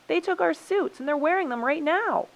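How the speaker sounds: high, loud, indignant complaint; heated storybook quarrel; accusing. A narrator's reading.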